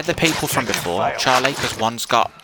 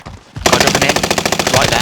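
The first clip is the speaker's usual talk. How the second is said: urgent callout; 2 semitones higher, 7 dB louder than usual. Real, not acted. Spotting enemy for teammate.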